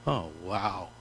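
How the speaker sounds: an unimpressed deadpan aside; flat sarcastic wow. Retold or imitated. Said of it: "God's up in heaven going".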